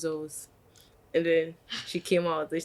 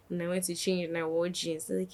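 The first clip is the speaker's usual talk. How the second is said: normal talking voice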